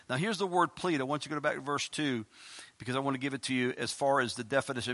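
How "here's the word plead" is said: ordinary talk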